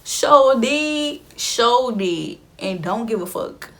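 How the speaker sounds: proud, defiant borrowed catchphrase; sassy little girl imitation. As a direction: start high and loud, then settle into finality. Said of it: "that little girl that snatched her wig up".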